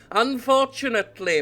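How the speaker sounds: sinister. Shown as a demonstration. Reading the scheming heir's line, now sinister. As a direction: make unfortunately sound sinister. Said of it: "needs a bit more of a sinister air".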